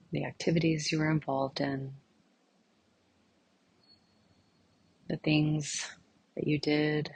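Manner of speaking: soft, unhurried meditation voice with long pauses; guided meditation. Real, not acted. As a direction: gently guide listeners inward, leaving silence between phrases.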